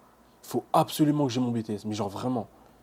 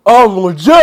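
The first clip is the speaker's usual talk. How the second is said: much louder, high joyful cry; ecstatic cry; 8 semitones higher, 19 dB louder, slower than usual. Real, not acted. Student marvels at his results, ecstatic cry. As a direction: cry out in disbelief. Overwhelmed joy.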